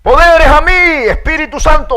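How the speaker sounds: hammed-up superhero summoning; mock superhero. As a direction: call for powers like a cartoon hero, hammed up. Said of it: "Que yo tengo una pelota que digo".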